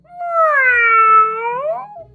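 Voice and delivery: high, loud stretched meow; kittens meowing. A narrator's reading. Three kittens answering mother, loud high meow. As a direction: sing out a long kitten meow. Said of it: "cried the three".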